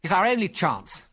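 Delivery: high-pitched urgent insistence; eager cry. A narrator's reading. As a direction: press it eagerly. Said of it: "he cried".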